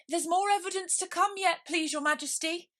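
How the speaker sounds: very high, hurried rabbit voice; flustered rabbit. Acted. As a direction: blurt it out like a nervous rabbit. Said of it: "jumping up in a great hurry".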